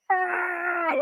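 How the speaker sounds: high, drawn-out mock scream; straining gym yell, way up high; imitated scream. Lifter cut to mid-scene, high mock scream. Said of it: "He's screaming".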